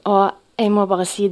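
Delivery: ordinary delivery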